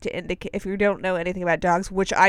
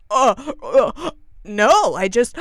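louder, gasping, broken-off protest; flustered stammer; 3 semitones higher, 8 dB louder, slower than usual. Retold or imitated. Woman stammering back when challenged, flustered. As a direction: stammer it, caught short of words. Acting out woman caught off guard.

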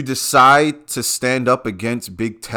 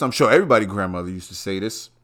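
normal talking voice

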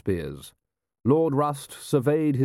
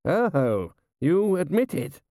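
slow, blustering aristocrat; pompous old general; 8 semitones higher, much slower than usual. A narrator's reading. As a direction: hold forth grandly on old glories.

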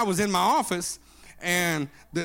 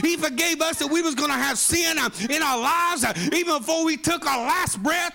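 preaching at full force; 7 semitones higher than usual. Acted. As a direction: preach forgiveness with rising force.

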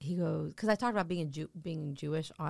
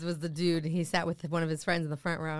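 ordinary delivery

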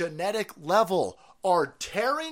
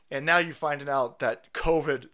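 normal talking voice

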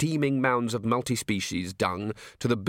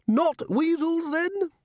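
very high, slow query; hopeful old herald; much slower than usual. Acted. Herald checking whether Vimes wants weasels, very high hopeful voice. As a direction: pitch it right up, tentatively. Herald returns to his offer.

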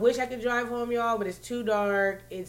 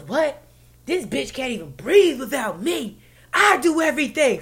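boastful; 5 semitones higher, 7 dB louder than usual. Retold or imitated. Man's boastful retort quoted.

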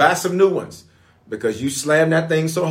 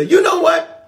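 high, louder fed-up outburst; fed-up quitter; 5 dB louder than usual. Retold or imitated. Preacher acting a fed-up quitter, high exasperated outburst. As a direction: blurt it like someone about to quit. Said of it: "you'll say".

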